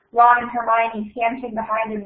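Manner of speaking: ordinary delivery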